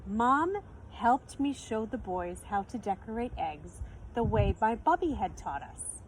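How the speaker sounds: ordinary delivery, nothing marked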